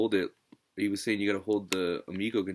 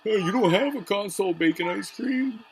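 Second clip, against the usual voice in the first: high, loud mocking voice; squeaky mocking impression of a rival streamer; taunting in a much higher, louder put-on voice; mocking DJC; 13 semitones higher, 7 dB louder than usual. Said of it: "D J C was going".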